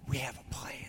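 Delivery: hushed whisper. Retold or imitated. Second guy shushes the littlest one in a whisper. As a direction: shush him and whisper the secret plan. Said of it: "the one next to him goes".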